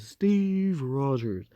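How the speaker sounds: slow, drawn-out "Steve Rogers" with mock gravity; lightly hammed-up duel call, name said slowly; dramatic challenge. Moriyasu calling out Steve before a duel, slow mock-dramatic. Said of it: "I'm not gonna say it like that".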